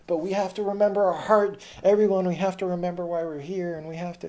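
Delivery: impassioned meeting speech, loud and high; Sage. Retold or imitated. Activist shutting down meetings with an appeal, impassioned. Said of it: "I love this guy named, um, Sage".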